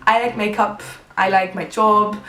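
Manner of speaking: put-on British English accent; French speaker attempting a British accent. Shown as a demonstration. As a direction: demonstrate a British accent on request. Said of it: "Est-ce que tu sais faire l'accent british?".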